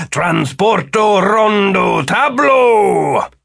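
loud, high, drawn-out chant; spell incantation. Acted. Wizard transporting them to the hall, loud incantation. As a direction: call out the magic words slowly and grandly.

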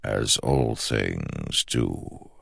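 slow, soft and hollow; slow ancient shadow voice. Acted. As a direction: speak like a patient shadow god. The shadow speaks.

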